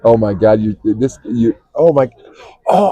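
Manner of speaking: usual speaking voice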